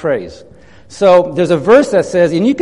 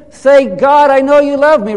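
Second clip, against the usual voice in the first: leading congregation aloud; 6 semitones higher, 6 dB louder than usual. Shown as a demonstration. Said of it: "You want to say that with me?".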